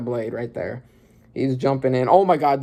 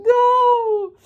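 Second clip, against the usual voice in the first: very high loud no; anguished cry; 5 dB louder than usual. Real, not acted. Watching the hero shot, crying out no in horror.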